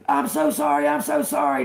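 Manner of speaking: high-pitched, hurried imitation of apologizing; mock apology. Passenger apologizing to angry driver, mock pleading. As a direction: mimic someone apologizing frantically. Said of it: "That dude was".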